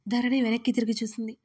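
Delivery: ordinary delivery, nothing marked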